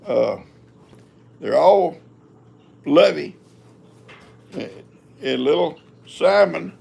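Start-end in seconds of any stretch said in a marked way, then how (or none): none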